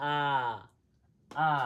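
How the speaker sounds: "আ" as open ah, no r sound. Shown as a demonstration. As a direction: drop the final r, just "আ". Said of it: "কি বলবো?". ("আ", শেষে র নেই)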